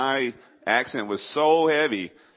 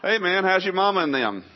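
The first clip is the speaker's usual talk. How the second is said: high-pitched East Texas hello; East Texas accent; 9 semitones higher, 4 dB louder than usual. Acted. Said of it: "That's the way you address people".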